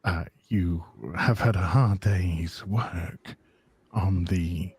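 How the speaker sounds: low, slow, deep trailer announcer; dramatic movie trailer read. Shown as a demonstration. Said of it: "Do your movie voice".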